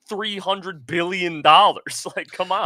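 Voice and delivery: dwelt-on, incredulous scolding; slow mock scolding. Telling the billionaire off, slow and incredulous. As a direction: spell out the sum in disbelief. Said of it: "I'm like".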